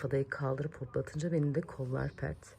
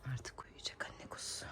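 whisper; 7 semitones lower, 15 dB quieter than usual. Real, not acted. Mother whispering beside her sleeping baby. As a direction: hush it so she stays asleep. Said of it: "Uyudu arkadaşlar Elif Ada".

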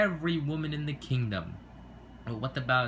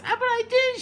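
squeaky protest; 6 dB louder than usual. Acted. Small sibling voice protests.

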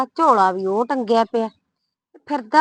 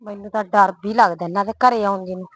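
ordinary delivery

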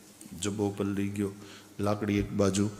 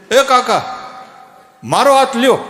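loud call; 10 semitones higher, 10 dB louder than usual. Acted. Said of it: "ખરેખર ઓલા જુવાનિયાએ કીધું".

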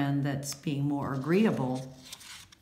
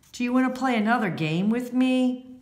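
eager invitation; 8 semitones higher, 3 dB louder, faster than usual. Acted. Cheerful invitation to play again.

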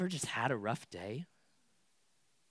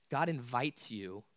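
ordinary talk

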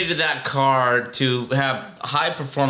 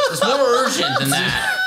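cracking up while yelling, much higher; laughing shout. Real, not acted. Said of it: "laughing shout".

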